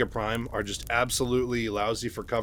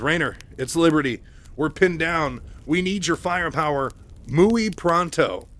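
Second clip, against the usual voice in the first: higher and louder, pleading for backup over the radio; urgent call for help; 6 semitones higher, 6 dB louder than usual. Retold or imitated. Mike, pinned down, radios Raynor for firepower, urgent and loud.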